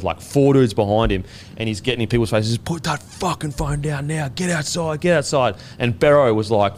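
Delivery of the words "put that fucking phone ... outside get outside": higher, strained mock barking, held back in volume; mock a sergeant's orders without full volume; imitated drill sergeant. Army sergeant getting in players' faces, mock drill-sergeant orders. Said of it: "army drill sergeant sprints in".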